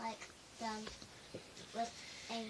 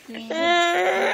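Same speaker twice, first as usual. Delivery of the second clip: loud cry; 8 semitones higher, 19 dB louder than usual. Real, not acted. Child reading, sudden cry.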